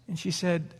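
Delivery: ordinary delivery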